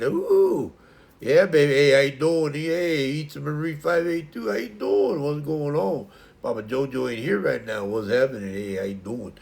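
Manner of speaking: loud whooping start, then jokey patter; hammed-up pizza guy. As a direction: play a swaggering pizza joint greeter. Acts out the pizza order character.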